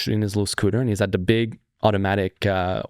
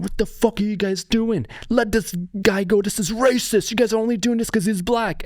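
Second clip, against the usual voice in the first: high-pitched, outraged mock yell; imitated yelling; 11 semitones higher than usual. Bystander yelling at the good Samaritans, high mock outrage. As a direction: play an irate bystander, voice pitched way up. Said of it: "starts yelling at the Good Samaritan".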